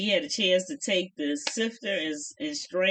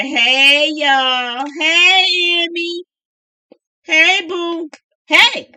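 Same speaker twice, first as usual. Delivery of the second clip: loud, high greeting shouted to the yard; cheerful shout to the daughters; calling out; 7 semitones higher, 11 dB louder, much slower than usual. Real, not acted. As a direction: holler hello across the room.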